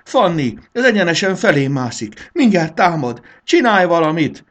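high, loud cries for help; panicked shouting. A narrator's reading. Marci calls Fanny as the caterpillar crawls at him, panicking. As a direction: yell for rescue, desperate.

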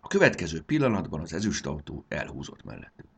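ordinary delivery

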